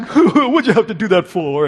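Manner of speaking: put-on voice of a bully gone wimpy; whimpering Cowardly Lion. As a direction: whine like you've just been slapped. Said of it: "and, and Dorothy slaps him".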